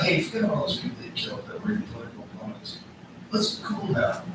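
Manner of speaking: high, hushed mock chumminess; mock coaxing. Mockingly playing a pal to a dictator, soft high coaxing. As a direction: pretend to smooth things over, high and soft.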